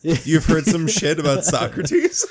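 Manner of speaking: real laugh running through a slow, amused question. Real, not acted. Friend laughing as he asks coworker about the rep. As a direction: laugh while asking, delighted at the gossip.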